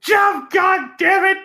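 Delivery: slow, high shouted curse; yelling director. Retold or imitated. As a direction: yell it like an angry director. Quoting movie director yelling.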